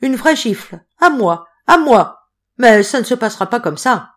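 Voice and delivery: indignant fury at the insult; the slapped clerk boiling over; outraged. A narrator's reading. Clerk raging about being slapped.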